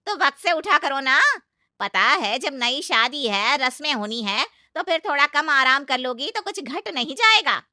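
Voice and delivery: loud, scolding lecture. Acted. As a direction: lay into her loudly. Aunt lectures bride.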